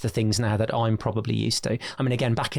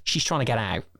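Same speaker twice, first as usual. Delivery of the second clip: ordinary talk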